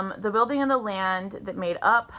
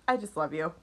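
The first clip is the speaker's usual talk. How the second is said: softly quoting the message; 6 semitones lower, 6 dB quieter than usual. Retold or imitated. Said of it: "Cody, just".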